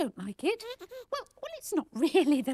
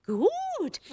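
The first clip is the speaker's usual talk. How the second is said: normal talking voice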